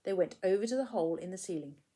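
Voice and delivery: usual speaking voice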